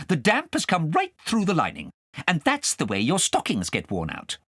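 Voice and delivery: scolding, high and sharp; cross governess. Acted. Governess scolding Kay over pork pie, cross. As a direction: tell him off like a strict governess.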